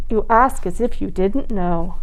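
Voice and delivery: ordinary delivery, nothing marked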